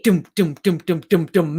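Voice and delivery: cliffhanger "dum dum" drums; imitated drum beats. Coach recalling EastEnders cliffhangers, imitated drum beats. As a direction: pound out evenly falling dramatic dums.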